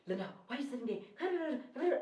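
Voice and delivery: imitating giddy fast talk. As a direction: rattle it off with too much energy. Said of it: "Now I'm busy talking fast".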